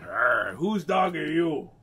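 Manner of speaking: loud, slow pirate growl; slow, arr-ing sea-dog voice. Acted. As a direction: drawl it like a hammy pirate. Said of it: "in a pirate voice".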